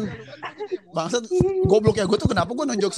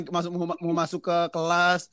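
ordinary delivery

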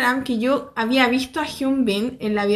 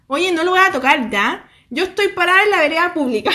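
raised, higher-pitched retold indignation; piqued protest; 4 semitones higher, 3 dB louder than usual. Vlogger retelling her standoff on the public sidewalk, piqued. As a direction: argue back, huffy, a laugh creeping in. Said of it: "me piqué mucho".

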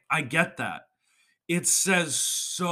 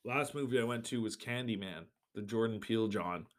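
normal talking voice

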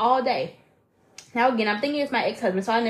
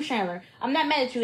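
normal talking voice

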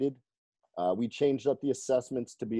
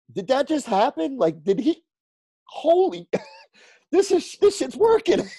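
high, loud stunned excitement breaking into laughter; amazed disbelief; 12 semitones higher, 6 dB louder than usual. Retold or imitated. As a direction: relive it high-pitched, incredulous and laughing. Retelling his amazed reaction.

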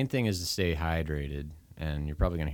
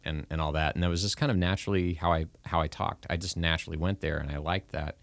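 usual speaking voice

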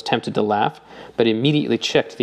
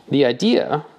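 plain reading voice

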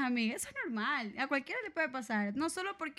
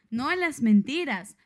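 loud and slow, stamping each word; loud, firm protest; emphatic no; 10 dB louder, slower than usual. Real, not acted. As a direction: declare it like a slogan. Firm declaration against lies.

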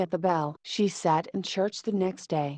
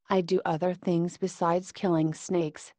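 usual speaking voice

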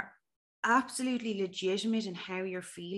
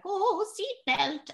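high, slow, drawn-out granny voice; granny impression; 13 semitones higher, 5 dB louder, much slower than usual. Nervous lad imagined as granny asking about seatbelt, granny impression. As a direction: imitate a granny, pitched way up and louder. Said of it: "dressed as kind of a granny being like".

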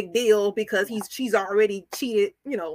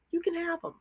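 breezy dismissal; 8 semitones higher, 8 dB quieter, faster than usual. Retold or imitated. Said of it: "I was like".

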